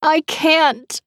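high, despairing moan. A narrator's reading. Captor moans she cannot put the knife down, high moan. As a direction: let it break into a moan. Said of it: "the woman moaned".

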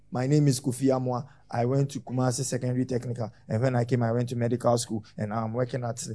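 low monotone recital, no stops; run-on without punctuation. Shown as a demonstration. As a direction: run the sentences together like unpunctuated reading. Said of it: "You just run like that".

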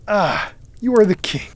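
high, emphatic outburst; crying out. A narrator's reading. Narrator confronts cousin about kingship, crying out. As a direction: exclaim with rising fervor. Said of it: "I cried".

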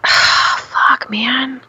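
unvoiced exasperated whisper; whispered curse. Mom at the poopy diaper, whispered curse. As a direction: breathe the curse out with no voice.

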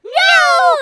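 sudden high loud shout. Acted. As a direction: yell it out high. Sudden outburst interrupting.

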